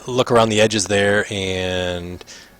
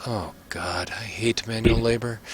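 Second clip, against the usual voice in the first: soft, breath-heavy complaint; hushed, weary griping; 8 dB quieter than usual. Acted. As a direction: grumble under your breath about the chore. Prince grumbles about mining work.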